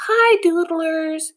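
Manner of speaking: high, slow, friendly hello; smiling greeting. Acted. Visiting teacher greeting the club, smiling and slow. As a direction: greet them with a big smile. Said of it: "she smiles".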